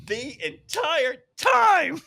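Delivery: high, slow, drawn-out emphasis on "time"; emphatic. Retold or imitated. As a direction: stretch "The entire time" out pointedly. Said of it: "I looked at her and I went".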